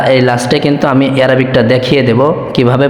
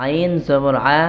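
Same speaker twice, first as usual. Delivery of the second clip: slow syllable-by-syllable spelling, intoned recitation; Arabic pronunciation drill; 6 dB quieter, slower than usual. Shown as a demonstration. Teacher building the dua for learners, slow chanted drill. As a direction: chant the joined phrases slowly, holding long vowels. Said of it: "আরবিটা কিভাবে উচ্চারণ করব".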